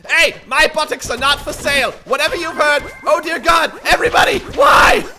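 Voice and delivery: high, hammed-up yelling; over-the-top pleading shout; mock outraged shout. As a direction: protest loudly in mock desperation. Mock protest while under attack.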